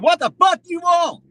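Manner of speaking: high, loud mock-furious snap at the door; angry retort. Imagined mayor snapping at a loud visitor, mock angry. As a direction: confront him like you own the hallway. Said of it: "come out the door and said".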